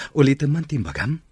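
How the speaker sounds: can't believe his ears, loud and eager; eager disbelief. Acted. Boss asking Jasmine to repeat her yes, eager and loud.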